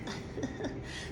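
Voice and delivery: breathy little chuckle; soft laugh. Real, not acted. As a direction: laugh quietly at your own joke. Laughs at own joke.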